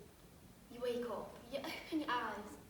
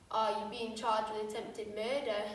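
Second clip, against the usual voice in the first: lower, slow, matter-of-fact cop; policeman; 6 semitones lower, slower than usual. Acted. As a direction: deliver the charge coolly and deliberately. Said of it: "Imagine he looks at you and goes".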